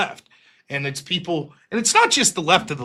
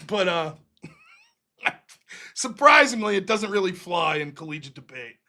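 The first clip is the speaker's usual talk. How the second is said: normal talking voice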